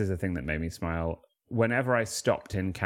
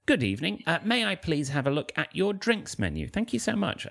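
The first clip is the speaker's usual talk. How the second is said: higher, prim posh English voice; put-on posh British; 10 semitones higher than usual. Shown as a demonstration. Posh British voice for a Kansas waitress. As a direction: play the polite posh Brit to the max. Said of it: "I put it on a bit as well".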